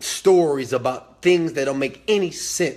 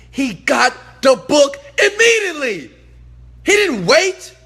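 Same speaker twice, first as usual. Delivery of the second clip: loud, high, triumphant hammering of the point; emphatic shout; 10 semitones higher, 7 dB louder than usual. Real, not acted. Speaker praising instant action on mentor's advice, emphatic shout. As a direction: belt it out to drive the lesson home.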